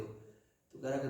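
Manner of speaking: ordinary delivery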